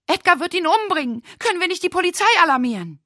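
high, loud, fast panicked shriek. Acted. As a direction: scream it out in terror. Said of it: "Gina kreischte".

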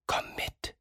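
soft unvoiced whisper from beside him; a hidden helper whispering close by. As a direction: breathe it like a secret. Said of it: "Da flüstert es neben ihm".

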